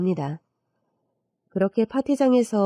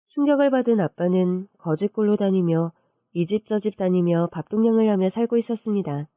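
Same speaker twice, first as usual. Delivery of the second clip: ordinary delivery, nothing marked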